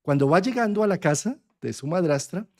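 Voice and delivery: usual speaking voice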